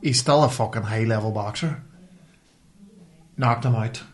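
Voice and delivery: ordinary talk, nothing marked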